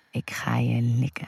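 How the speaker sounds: husky seductive murmur; hoarse. A narrator's reading. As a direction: say it hoarsely, close and intimate. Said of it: "zegt ze schor".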